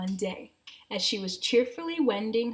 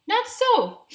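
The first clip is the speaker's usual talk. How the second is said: sniffing woodchuck; 13 semitones higher, 6 dB louder than usual. Acted. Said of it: "sniffed the woodchuck".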